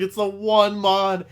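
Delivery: slow, high, loud lament. Retold or imitated. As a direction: drag it out like a loss. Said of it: "I was like".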